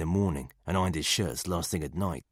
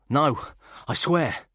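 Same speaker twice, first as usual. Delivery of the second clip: frightened answer; 8 semitones higher, 6 dB louder, much slower than usual. Acted. Wounded man questioned at gunpoint.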